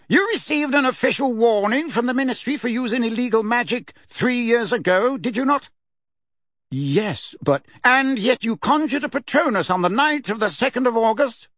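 pompous official droning through a legal indictment; Fudge reading charges. A narrator's reading. Fudge interrogating Harry.

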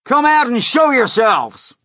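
loud, high shouted order; commanding holler; police chief shouting. A narrator's reading. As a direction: demand it at full volume from far below. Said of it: "shouted the chief of police".

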